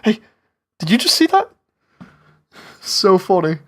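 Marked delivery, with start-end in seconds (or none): 0.0-1.5 quick excited whisper-call. Retold or imitated. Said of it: "grabbing him real quick and just be like".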